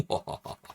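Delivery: slow, staged evil laugh; mock vampire laugh. Streamer capping the spooky announcement, mock vampire laugh. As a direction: laugh like a hammy Dracula.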